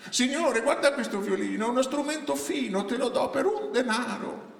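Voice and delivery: high, sing-song market seller's pitch; brigand woman disguised as vendor, wheedling. Acted. Disguised brigand woman hawks a stolen violin to a passer-by, wheedling high voice. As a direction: coax the buyer in a high character voice.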